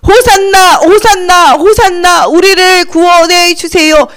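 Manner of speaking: high, loud, slow chant; drawn-out acclaiming cry; crowd shouting. Acted. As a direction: call it out triumphantly. Repeats the crowd's Hosanna cry.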